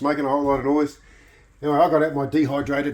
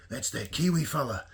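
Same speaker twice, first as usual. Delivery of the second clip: softer, lightly imitated Yorkshire accent; 2 semitones lower, 8 dB quieter than usual. Rugby player imitating the crowd in a Yorkshire accent. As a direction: put on a touch of Yorkshire. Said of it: "in their Yorkshire accents going".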